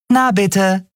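high, pert little voice with a grin; gleeful I-told-you-so; grinning. A narrator's reading. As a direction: crow it through a grin, pitched high. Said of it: "sagte Nelen grinsend".

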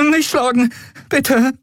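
put-on very high, pleading boy voice; cowering high-pitched plea; frightened boy. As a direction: plead in a high voice not to be hit. Cowering from a raised arm.

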